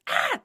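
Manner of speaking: sudden high playful shriek; a silly penguin screech to startle the boy; mock penguin scream. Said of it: "And then she screamed".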